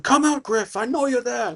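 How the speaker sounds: high, strained mock shout through a door; acted bandit hollering for someone inside; barbarian calling out. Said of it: "big barbarian-looking dude".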